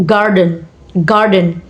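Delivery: GARden, first syllable strong and falling away; stress on first syllable. Shown as a demonstration. Teacher showing primary stress, garden stressed on GAR. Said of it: "Stress on first syllables".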